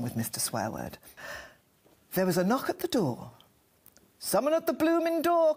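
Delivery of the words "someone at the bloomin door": higher-pitched call of alarm; crying out. A narrator's reading. Mister Swearword reacts to a knock at the door, crying out. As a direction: call it out high. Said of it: "cried Mister Swearword".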